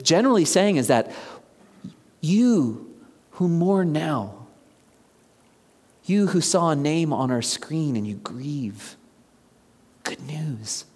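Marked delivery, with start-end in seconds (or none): 2.2-9.0 tender, hushed comfort. Shown as a demonstration. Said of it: "Do you hear that?". 10.0-10.8 good news said very soft and gentle; hushed good news. Shown as a demonstration. Preacher comforting mourners in the pews, hushed and tender. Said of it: "Do you hear that?".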